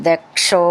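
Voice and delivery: ദക്ഷോ with the ക്ഷ said firmly and clearly; firm consonant. Shown as a demonstration. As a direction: press the ക്ഷ hard in ദക്ഷോ. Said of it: "നന്നായിട്ട് ഉറപ്പിച്ച് പറയണം".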